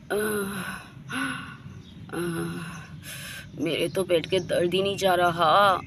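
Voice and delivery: low, soft, weary moaning; acted stomach pain. Kajal lies alone with a bad stomach ache, weak and pained. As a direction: groan softly through a bellyache, keeping the pitch low.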